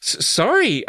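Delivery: high, stammered apology. A narrator's reading. Girl apologises to the gnomes, stammering. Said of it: "I stammered".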